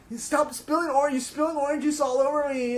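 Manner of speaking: high, loud whiny mock Nancy; mimicking Nancy. Imitating Nancy scolding Sid over spilled juice, high and whiny. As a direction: squeal it like a nagging girlfriend. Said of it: "Nancy's like".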